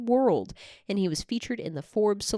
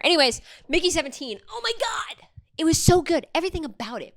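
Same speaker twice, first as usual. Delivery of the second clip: gushing; 8 semitones higher, faster than usual. Real, not acted. Gushing about the film.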